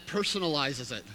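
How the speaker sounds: ordinary talk, nothing marked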